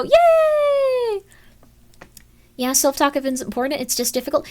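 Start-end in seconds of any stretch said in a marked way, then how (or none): 0.0-1.2 squeaky high cheer; a sudden, very high delighted yay; brain cheering. Pretend. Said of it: "your brain will go".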